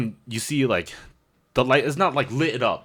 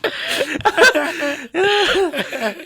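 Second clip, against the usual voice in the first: very high cracked laughter; helpless giggling fit; laughing hard; 5 dB louder than usual. Real, not acted. Laughing at own panic.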